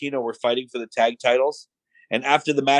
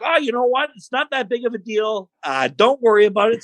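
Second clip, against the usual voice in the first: high-pitched dismissive retreat; flustered backing down; 10 semitones higher than usual. Retold or imitated. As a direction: imitate someone desperate to drop it. Wrestler frantically backs down.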